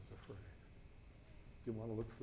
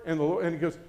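ordinary talk

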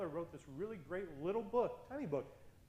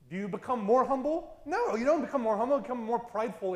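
louder and higher, with a laugh in the no; preaching loudly; 6 semitones higher, 12 dB louder than usual. Real, not acted. Preacher to congregation on humility, loud and laughing. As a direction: punch the question, then laugh it off.

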